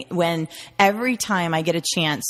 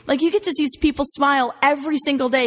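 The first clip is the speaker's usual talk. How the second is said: upbeat dude talk; 7 semitones higher than usual. Retold or imitated. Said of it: "I said".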